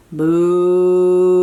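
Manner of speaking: a loud, drawn-out mooing cow. Acted. Reading to a child, loud cow moo. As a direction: moo like a big friendly cow. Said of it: "The cow goes".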